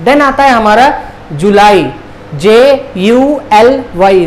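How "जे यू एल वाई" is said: slow, letter by letter; spelling out. Shown as a demonstration. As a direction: spell it out slowly with a gap after each letter. Spelling the month for learners. (एक-एक अक्षर धीरे-धीरे)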